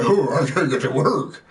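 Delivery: mock deep kid voice; deep voice imitation. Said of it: "look how deep his voice is".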